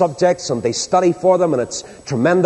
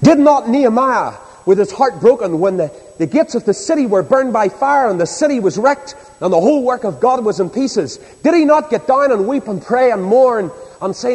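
raised, driving pulpit oratory; impassioned preaching; 5 semitones higher than usual. Real, not acted. Preacher recalls Nehemiah mourning the burned city, impassioned preaching. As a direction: build the rhetorical question phrase upon phrase. Preacher building a rhetorical question.

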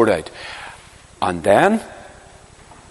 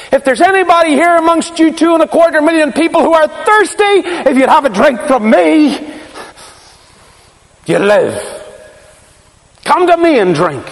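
crying out; 12 semitones higher than usual. Retold or imitated. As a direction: call out to the whole city. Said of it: "Crying like that, all on his own?".

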